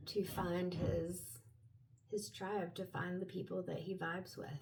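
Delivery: soft, hushed invocation to guides; quiet prayer. Real, not acted. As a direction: softly ask the spirits for guidance. Prays over the cards.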